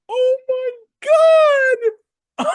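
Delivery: squealed astonishment; high-pitched shock. Retold or imitated. Fan hearing a wrestler swear on air, squealing in shock. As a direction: let the voice leap way up, aghast.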